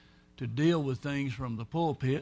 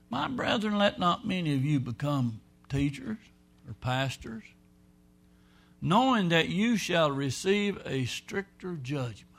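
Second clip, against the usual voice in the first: scripture reading; 4 semitones higher, 3 dB louder than usual. Acted. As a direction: read it like holy writ, giving it weight. Reads scripture aloud.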